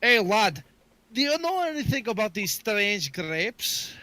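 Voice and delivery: Scottish brogue, played up; Scottish accent. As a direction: call to him like a friendly Scot. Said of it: "I gotta say it in my Scottish accent".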